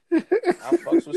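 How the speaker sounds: slow, high, laughing through it; laughing, drawn out. Shown as a demonstration. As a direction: stretch it out while laughing. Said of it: "repeats line drawn out laughing".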